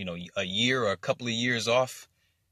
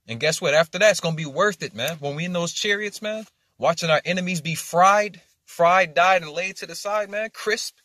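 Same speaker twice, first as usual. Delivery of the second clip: higher, louder, faster preaching as it builds; fired-up preaching; 7 semitones higher, 6 dB louder than usual. Real, not acted. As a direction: preach it like a celebration, rising to a shout on fried. Excited preaching about chariots.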